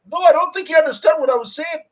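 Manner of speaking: very high, strained imitation of protesting; high-pitched mock protest. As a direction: squeak out a put-on objection. Retelling a misunderstood man's protest.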